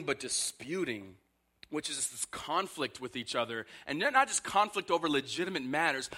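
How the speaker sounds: ordinary talk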